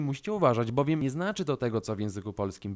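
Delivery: ordinary talk, nothing marked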